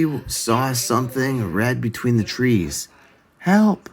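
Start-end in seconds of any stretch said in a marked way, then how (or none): 3.4-3.9 high, louder shout for help; cry for help. A narrator's reading. Santa shouting for help through the trees. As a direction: call out clearly for help. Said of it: "he now heard loud and clear".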